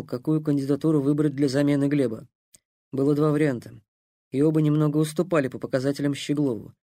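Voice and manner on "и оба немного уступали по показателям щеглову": ordinary delivery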